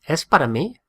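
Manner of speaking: "Es para mí" rising into a question; teacher shows a question by tone alone; question tune. Shown as a demonstration. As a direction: lift the pitch at the end of "Es para mí". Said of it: "Just change the tone of our voice".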